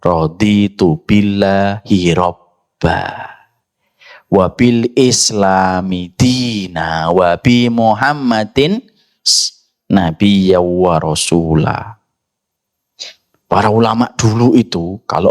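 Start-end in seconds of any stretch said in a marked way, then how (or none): 0.0-12.0 slow, drawn-out recitation of the dhikr; zikir recitation. Shown as a demonstration. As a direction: recite it slowly with long held vowels. (melafalkan zikir dengan lambat)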